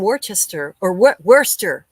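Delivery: "Worcester" as spelled, then fixed to "Wooster"; corrected pronunciation. Real, not acted. Streamer correcting herself on a viewer's English town, corrected pronunciation. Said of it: "I was taught how to say it properly".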